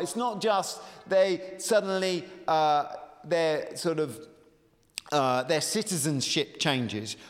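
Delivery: ordinary talk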